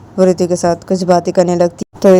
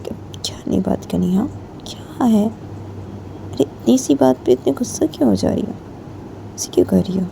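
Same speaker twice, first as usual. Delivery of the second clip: gentle coaxing; 6 dB quieter than usual. Acted. Soothing the angry friend. (धीमी आवाज़ में मनाना)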